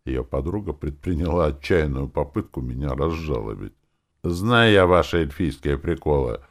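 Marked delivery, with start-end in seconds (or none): 4.2-6.4 querulous, rising in pitch and volume; peevish, nagging complaint; grumpy. A narrator's reading. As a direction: carp at them loudly. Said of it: "проговорил я сварливо".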